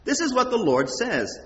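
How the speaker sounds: ordinary talk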